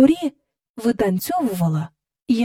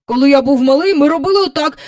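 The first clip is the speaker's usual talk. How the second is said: put-on louder, self-important old man; pompous keeper; 4 semitones higher, 7 dB louder, faster than usual. Park keeper takes over from the children, pompous and loud. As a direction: step in with importance. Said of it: "поважно сказав доглядач".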